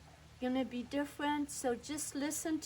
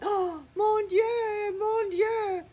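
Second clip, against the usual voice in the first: much higher, louder exclamation in a put-on old French voice; hammed-up grandmother crying mon Dieu; 9 semitones higher, 8 dB louder, slower than usual. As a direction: throw up your hands like an excited French grandmother. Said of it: "and she went".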